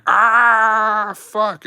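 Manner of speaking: long, high, loud "ah" of pain; imitated pain scream. Patient as the doctor pops his nail up, imitated high scream. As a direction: hold a high mock scream, then curse.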